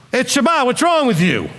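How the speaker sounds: a hint of picking a fight; aggressive retort. Shown as a demonstration. The wrong way to answer a relative, mildly mock-aggressive. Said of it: "we can get loud and angry".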